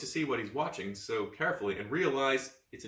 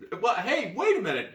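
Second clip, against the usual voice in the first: higher, louder call; calling after; 9 semitones higher, 7 dB louder than usual. Acted. Child calls after the boy running away, loud. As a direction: call out chasing him. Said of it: "you call, running after him".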